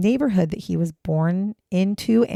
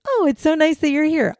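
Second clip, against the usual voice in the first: mock mother; 11 semitones higher, 3 dB louder than usual. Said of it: "His mother is like".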